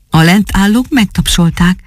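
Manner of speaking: ordinary delivery, nothing marked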